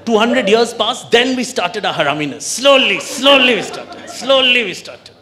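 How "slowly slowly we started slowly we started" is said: slow, stretched words; mock slow creep; drawn out slowly. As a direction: drag out each slowly.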